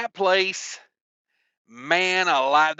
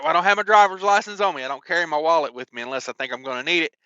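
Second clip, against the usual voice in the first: fast explaining; much faster than usual. Retold or imitated. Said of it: "I'm like".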